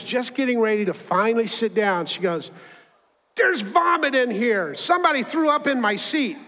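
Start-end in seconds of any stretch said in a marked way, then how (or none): 3.3-6.4 outraged outburst. Retold or imitated. Lady discovers smell as she sits down, hammed-up outrage. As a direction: imitate an appalled passenger going off, pitched way up. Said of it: "she just starts going off".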